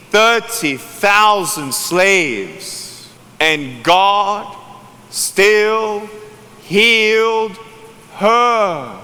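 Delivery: very slow, weighty preaching with pauses; drawn-out, emphatic sermon climax; solemn climax. Real, not acted. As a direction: set each word apart, building to the end.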